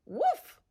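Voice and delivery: high loud woof; dog woof. Acted. Sled dog answering Pip with a loud woof. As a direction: bark like a dog.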